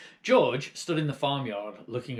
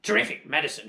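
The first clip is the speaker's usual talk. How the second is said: excited cry; 4 semitones higher, 6 dB louder, faster than usual. Acted. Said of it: "she cried".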